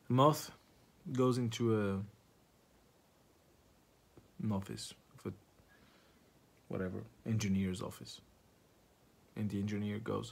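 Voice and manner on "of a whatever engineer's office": usual speaking voice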